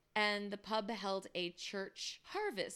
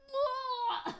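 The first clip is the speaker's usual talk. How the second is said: mock retch; 6 dB louder than usual. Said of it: "are just like".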